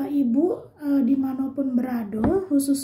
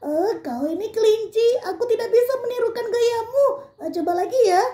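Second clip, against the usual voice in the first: higher, amused rhino talking to the rabbit; medium rhino voice; 8 semitones higher, faster than usual. Acted. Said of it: "kata badak".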